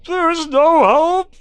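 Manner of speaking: loud high howl, drawn out; slow loud anguished cry; despairing shout. Acted. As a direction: bellow it in despair. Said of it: "he shouts".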